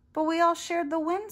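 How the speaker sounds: high, loud storybook protest; acted defensive outcry. A narrator's reading. Clark protests to cranky teammates.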